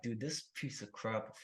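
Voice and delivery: muttered grumble. Retold or imitated. Cursing the salesman to himself, quiet slow grumble. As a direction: grumble it under your breath. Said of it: "I'm like".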